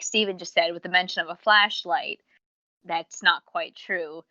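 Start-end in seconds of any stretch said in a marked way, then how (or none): none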